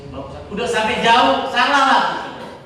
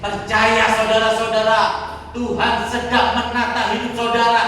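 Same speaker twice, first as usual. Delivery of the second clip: raised, insistent preaching cadence; forceful preaching; 5 semitones higher, 6 dB louder than usual. Real, not acted. Preacher promising God's care to the congregation, rousing and forceful. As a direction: proclaim each promise, pushing the phrase ends.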